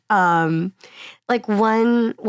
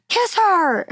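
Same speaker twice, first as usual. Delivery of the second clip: mock shout from audience; 10 semitones higher, 3 dB louder than usual. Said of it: "people will shout".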